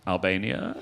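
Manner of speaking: low, coaxing questioning tone; hopeful judge. Retold or imitated. Judge coaxing the lone bearded Albanian. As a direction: ask it gently toward one man. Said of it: "She goes".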